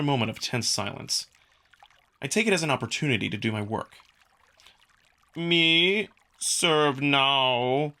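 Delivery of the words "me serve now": lightly voiced caveman, louder, clipped words; caveman speech. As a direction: play a mock caveman talking. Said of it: "my own caveman speech".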